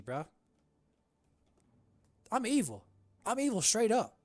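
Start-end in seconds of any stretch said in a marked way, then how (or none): 2.3-4.1 gleeful villain boast. Real, not acted. Declaring villainy.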